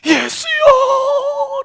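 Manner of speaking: long drawn-out high wail; dying man's final desperate plea to Jesus; desperate cry. Pretend. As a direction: cry out the name with your last breath. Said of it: "내 인생의 마지막 처절한 목소리로".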